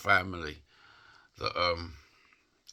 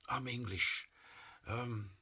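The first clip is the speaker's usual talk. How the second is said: upset; 4 semitones higher, 4 dB quieter than usual. Real, not acted. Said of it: "it upsets me now".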